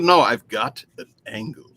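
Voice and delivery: low jaw-out quote; Eugene Levy voice. Retold or imitated. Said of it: "that was Eugene".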